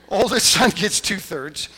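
laughing as it's restated. Real, not acted.

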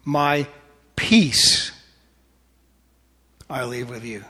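slow phrase with peace standing out; stress on peace. Shown as a demonstration. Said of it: "Emphasize one word".

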